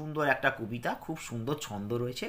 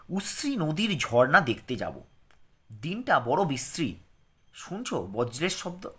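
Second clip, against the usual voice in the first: clear, careful lesson reading for pupils; reading aloud; 3 semitones higher, 5 dB louder, slower than usual. Acted. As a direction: read it out slowly for children to follow. Teacher reads the lesson text.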